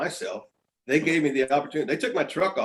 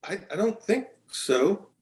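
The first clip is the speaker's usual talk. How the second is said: doubtful; 7 semitones higher, slower than usual. Retold or imitated. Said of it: "And I went".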